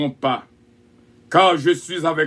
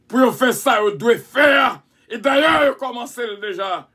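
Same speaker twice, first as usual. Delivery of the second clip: fiery preaching; 8 semitones higher than usual. Acted. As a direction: drive it home to the crowd. Preacher builds to full force.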